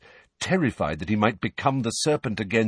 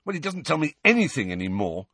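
higher, frustrated complaint; impatient; 10 semitones higher than usual. A narrator's reading. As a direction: push back, fed up. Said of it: "said Harry impatiently".

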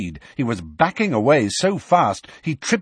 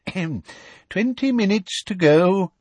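lightly voiced professor, higher pitched; Professor Tofty; 7 semitones higher than usual. A narrator's reading. Exam professor calling time to students, lightly voiced professor. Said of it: "said Professor Tofty".

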